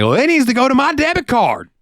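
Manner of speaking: high mocking falsetto of an entitled customer. Cashier mimics a pushy customer in a high whiny falsetto. Customer voiced in retold quarrel.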